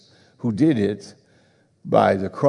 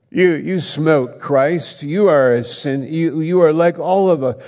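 loud, raised accusing sermon; preacher driving guilt home to the congregation, loud; fiery preaching; 4 semitones higher, 5 dB louder than usual. Acted. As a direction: hammer each "you" like a verdict. Full-force preaching of guilt.

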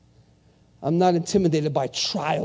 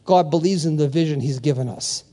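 ordinary talk

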